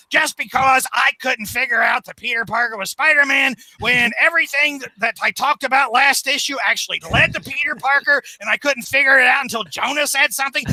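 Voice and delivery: mock the fool like a gloating supervillain; Goblin voice. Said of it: "the Goblin says".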